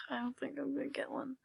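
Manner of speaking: bored whisper. Retold or imitated. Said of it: "I was like".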